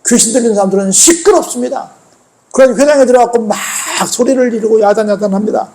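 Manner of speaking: normal talking voice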